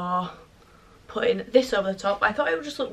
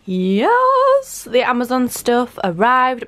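excited cheer; 3 semitones higher, 5 dB louder, much slower than usual. Real, not acted. Vlogger celebrates her parcel arriving, excited cheer. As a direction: squeal it in triumph.

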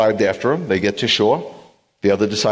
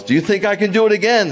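high, raised call; yelling to crowd; 12 semitones higher, 3 dB louder than usual. Retold or imitated. Tightrope walker yelling to the crowd, raised and high. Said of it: "he yells".

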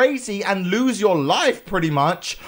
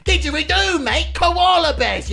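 high-pitched mock Australian accent; hammed-up Aussie, pitched way up; 13 semitones higher, 3 dB louder than usual. Player trying out the Australian accent option, high mock Aussie. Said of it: "have an Australian accent".

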